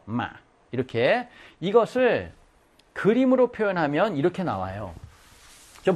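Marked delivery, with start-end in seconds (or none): 0.0-0.3 마 very short, at low pitch. Shown as a demonstration. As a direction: demonstrate Vietnamese 마 as a brief low note. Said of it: "짧아".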